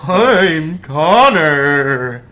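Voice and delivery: slow, loud drawn-out ghost voice; scary voice. Acted. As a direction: groan it like a ghost. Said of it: "I'll try another scary voice".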